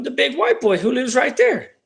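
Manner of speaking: put-on high kid voice pointing out the neighbour; a kid helpfully telling the cops; kid answering cops.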